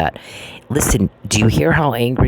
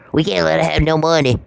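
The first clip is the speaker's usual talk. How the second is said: higher, whiny mock panic; put-on, pleading mockery; mocking plea; 5 semitones higher than usual. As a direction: mimic opponents pleading in exaggerated alarm. Same mocking voice of her opponents.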